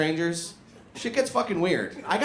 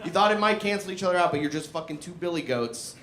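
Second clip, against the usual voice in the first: ordinary delivery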